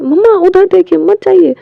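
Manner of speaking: put-on high, loud pleading child voice; child's loud plea. Little son loudly begs his mother not to go, high pleading. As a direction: beg mummy loudly not to leave.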